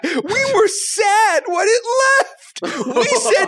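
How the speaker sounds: high, laughing, half-crying; laughing mock wail. Real, not acted. Laughing, mock-wailing nostalgia.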